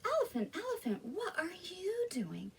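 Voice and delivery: high, curious piglet voice; little pig. Acted. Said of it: "the little pig said".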